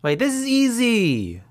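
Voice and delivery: high eager burst; excited. Real, not acted. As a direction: blurt it out eagerly. Excited contestant.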